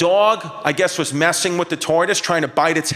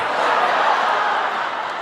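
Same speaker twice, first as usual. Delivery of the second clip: wheezing laugh. Real, not acted. Laughing at the dog's death.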